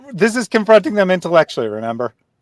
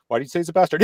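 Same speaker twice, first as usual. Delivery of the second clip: usual speaking voice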